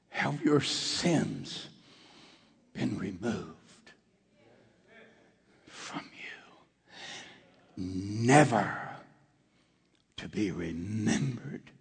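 hushed pastoral assurance; tender reassurance. Acted. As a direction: lay it down gently like a blessing. Gentle reassurance of forgiveness closing.

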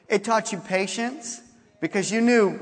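ordinary talk, nothing marked

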